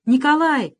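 loud high cry of despair; desperate cry. A narrator's reading. Boy begs Nikolai not to leave, desperate cry. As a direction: call him back desperately. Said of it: "крикнул с отчаянием".

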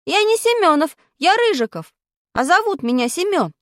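put-on indignant boy. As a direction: correct her name mistake. Boy correcting teacher.

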